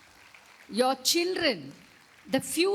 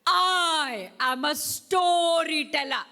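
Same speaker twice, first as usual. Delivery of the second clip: louder, higher, slow with a laugh; grand slow announcement with a laugh; proud storyteller voice; 6 semitones higher, 5 dB louder, slower than usual. Shown as a demonstration. Teacher repeating the class call, grand and laughing. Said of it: "Let's do it again".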